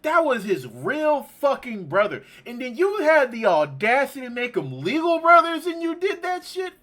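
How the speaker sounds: high, climbing accusation; outraged. Real, not acted. Gamer scolding the game for breaking up brothers, outraged.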